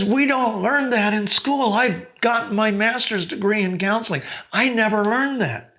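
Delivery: high, awed exclamation; astonished admission. Retold or imitated. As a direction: marvel that school never taught this. Counselor confessing amazement.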